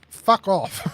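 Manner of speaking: a bird's rasping "Fuck off", flat and curt; lightly voiced parrot swearing; talking bird. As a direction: squawk "Fuck off" like a rude cockatoo. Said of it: "the bird just looked at him and goes".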